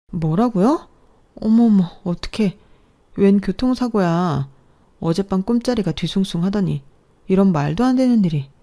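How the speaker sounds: shocked alarm. Acted. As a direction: react to terrible news on the phone.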